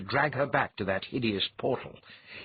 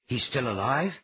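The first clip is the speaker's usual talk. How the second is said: ordinary delivery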